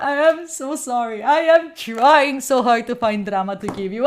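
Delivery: ordinary talk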